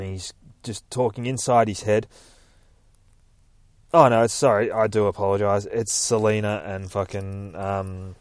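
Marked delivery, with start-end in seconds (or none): none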